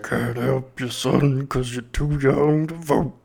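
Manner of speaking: slow, put-on monster voice. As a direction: drag the line out like a monster. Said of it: "Sounds like a monster".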